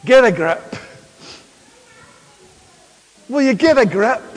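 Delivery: put-on light Northern Irish accent on a firm telling-off. Someone exasperated telling another to get a grip, Northern Irish accent. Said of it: "That was my best Northern Irish accent".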